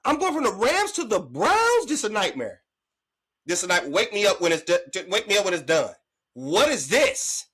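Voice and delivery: high, loud hammed-up disbelief at a trade; over-the-top dismay, voice pushed up high; mock panicked player. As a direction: play the freshly traded player losing it. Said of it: "I'm like".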